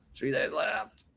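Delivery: slow, whispery confirming echo; soft, drawn-out answer; hushed confirmation. Real, not acted. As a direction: drop to a slow near-whisper, falling. Confirms the days left in answer.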